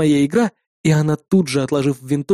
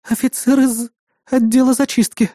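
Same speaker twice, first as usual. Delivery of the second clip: high-pitched fearful answer; frightened; 8 semitones higher than usual. A narrator's reading. Girl naming officer with knife at throat, frightened. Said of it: "испуганно ответила она".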